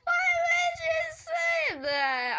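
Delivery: very high, drawn-out squeal; squeaky hammed-up indignation, slow; mock outrage. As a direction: wail in exaggerated protest.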